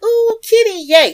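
high, loud mock-delighted squeal; gushing cat lover. Host imitates people who think the Yule cat is nice, gushing squeal. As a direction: coo and cheer over a kitty. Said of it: "Most people would think".

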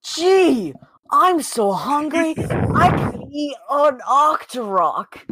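high, slow goofy cartoon hero; cartoon Link voice. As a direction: ham up a nineties cartoon Link. Imitating cartoon Link line.

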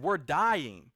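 brief acted outburst; freaking-out disciples. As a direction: cry out as a panicked disciple. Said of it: "and they're freaking out".

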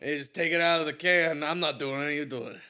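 put-on tough-guy Brooklyn accent, laughing. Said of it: "I turn into a Brooklyn person".

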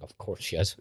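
mock resignation breaking into laughter; deadpan then a squeal of laughter; laughing sarcastically. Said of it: "I'm like".

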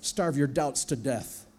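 slow solemn command; slow grave voice of God. Acted. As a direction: speak it slowly as God. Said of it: "God says".

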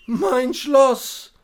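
high, loud cry of shock; dismayed cry. Acted. Knight cries out at the sight of his castle: loud dismay.

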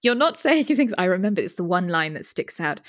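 ordinary delivery

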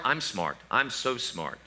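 soft, empty self-affirmation. Shown as a demonstration. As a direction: repeat it quietly to yourself. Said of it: "look in the mirror and say you're smart".

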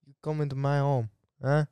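lightly menacing mock Italian mobster; half-hearted Italian mob boss taking offence; Italian mobster accent. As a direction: confront them as a pretend Italian mobster. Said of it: "do your Italian mobster accent".

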